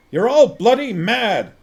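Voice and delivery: loud, high exasperated shout. A narrator's reading. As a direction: yell it at the lot of them. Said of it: "shouted Vimes".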